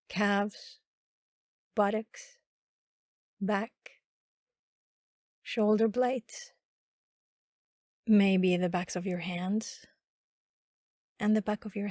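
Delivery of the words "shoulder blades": ordinary talk, nothing marked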